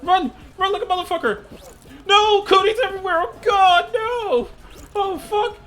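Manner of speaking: shrieking in mock terror, very high; terrified horror victim. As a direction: scream like a horror movie victim. Panicked screaming during cootie infection.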